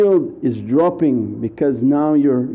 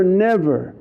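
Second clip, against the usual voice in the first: ordinary talk, nothing marked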